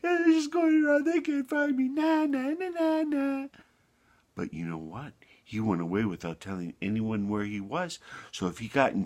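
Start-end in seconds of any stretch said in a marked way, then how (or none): 0.0-3.5 squeaky high, teasing lamb voice; gleeful sing-song lamb taunt; little lamb mocking. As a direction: make fun of them in a tiny high voice. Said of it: "he was just making fun of them".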